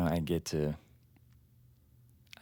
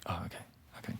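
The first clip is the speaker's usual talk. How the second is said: whispered; 2 semitones lower, 10 dB quieter, much faster than usual. Acted. Flustered whisper while touching hair.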